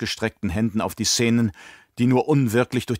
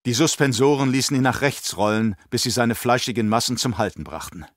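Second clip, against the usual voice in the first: ordinary delivery, nothing marked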